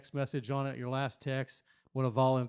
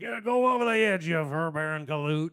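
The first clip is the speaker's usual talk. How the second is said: Yosemite Sam; 3 dB louder than usual. Acted. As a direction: holler like an angry cartoon cowboy. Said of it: "Yosemite Sam, we chasing Bugs Bunny".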